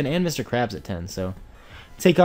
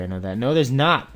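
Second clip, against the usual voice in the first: usual speaking voice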